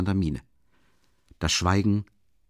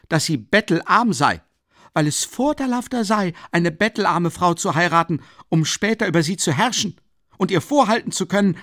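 high, loud, spiteful tirade; furious accusation; 8 semitones higher, 5 dB louder than usual. A narrator's reading. Raskolnikow wirft Luschin wütend und höhnisch vor. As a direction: relish the insult, voice climbing. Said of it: "mit vor Wut bebender Stimme".

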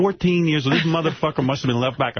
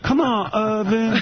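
put-on high, slow street-kid pleading; street voice from his youth; 9 semitones higher, slower than usual. Pleading with the bully Irvin in his old street voice. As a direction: whine at the bully like a scared teen. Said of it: "I'm like Oprah in reverse. I used to be like".

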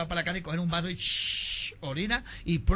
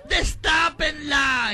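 higher, louder, very slow wailed shout; wailing shout; 8 semitones higher, 6 dB louder, much slower than usual. Acted. Daughter shrieks for the coffin to be opened, loud slow wail. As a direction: wail it out at full volume.

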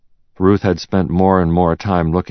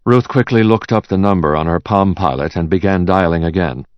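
usual speaking voice

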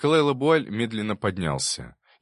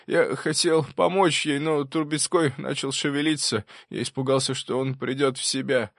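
higher-pitched, strained testimony with halting pauses; professor confessing, agitated; 7 semitones higher than usual. Acted. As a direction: confess the killing, pausing as the memory hurts. (взволнованная исповедь профессора, с паузами)

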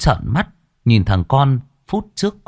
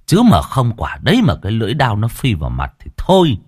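usual speaking voice

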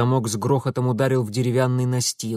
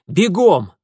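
loud, higher one-word command; urgent order; 6 semitones higher, 8 dB louder than usual. Acted. Mitya hurries the old woman to the boat, urgent order. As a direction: shout it to get them running.

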